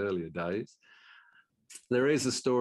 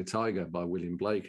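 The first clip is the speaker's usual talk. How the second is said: usual speaking voice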